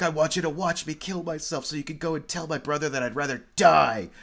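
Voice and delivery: hammed-up tragic rant; mock melodrama. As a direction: rush it like a soap villain. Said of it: "the most melodramatic scene".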